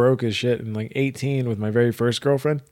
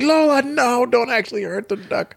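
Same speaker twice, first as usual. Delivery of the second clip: high, pleading dog owner; panicked owner; 11 semitones higher, slower than usual. Retold or imitated. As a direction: beg the dog to stop. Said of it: "And I was like".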